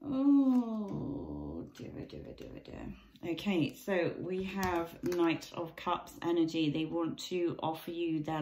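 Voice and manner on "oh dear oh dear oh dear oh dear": dismayed oh dear. Real, not acted. Reacting to drawn tarot card.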